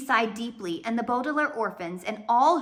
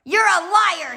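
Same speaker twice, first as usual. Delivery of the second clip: hissed; 9 semitones higher, 9 dB louder than usual. Acted. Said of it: "he hissed at Violet".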